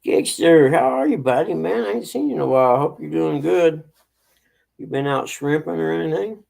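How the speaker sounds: loud, fast, cheerful hello; excited greeting. Real, not acted. Greeting a friend in chat.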